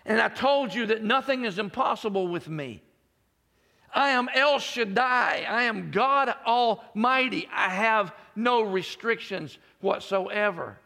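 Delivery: high, loud preaching climax; full-force preaching. Acted. Preacher proclaims God's promise to the congregation, full force.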